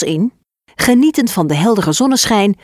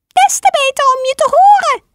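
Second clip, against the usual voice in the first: much higher, fast squeak; disguised wolf squeaking quickly; squeaky granny voice; faster than usual. Acted. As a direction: rattle it off in a tiny squeak. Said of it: "piepte de wolf".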